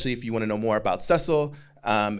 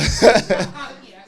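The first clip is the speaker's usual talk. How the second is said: high, loud laugh; burst of laughter; 12 semitones higher, 9 dB louder than usual. Real, not acted. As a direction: break into a big laugh. Laughing during podcast banter.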